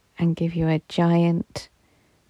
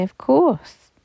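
delighted; 8 semitones higher, 3 dB louder than usual. Acted. Angels answer warmly, delighted.

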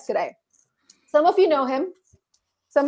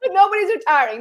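raised, insistent denial; emphatic; 6 semitones higher than usual. Real, not acted. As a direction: insist on it with a lift. Two contrasting takes.

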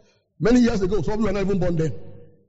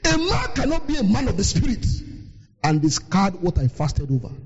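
ordinary talk

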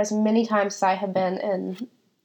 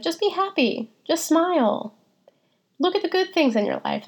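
imitating dismissive people, much higher and singsong; mocking dismissive advice; 9 semitones higher than usual. Telling listeners what people said to her when depressed, high mocking voice. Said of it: "people will just be like".

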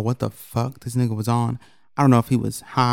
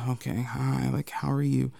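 polite greeting; 10 dB quieter than usual. Retold or imitated. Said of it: "So it's like".

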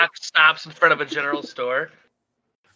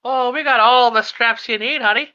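higher, flirtatious shopkeeper voice; 8 semitones higher, 3 dB louder than usual. Acted. Shopkeeper flirts with Shock, high and coy. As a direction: coo it at him.

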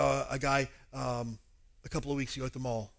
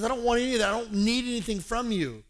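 high, forceful disclaimer; firmly disown any self-interest as Paul; emphatic denial; 7 semitones higher, 4 dB louder than usual. Retold or imitated. Paul answering accusations of greed, emphatic. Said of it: "Paul says".

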